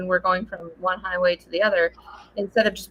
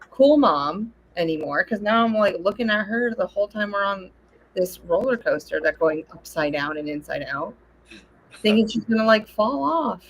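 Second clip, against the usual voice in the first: usual speaking voice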